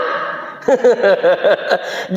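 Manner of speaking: long rolling chuckle; amused laugh. Real, not acted. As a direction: chuckle on and on at the absurdity. Laughs at feuding mosques. (tawa geli panjang)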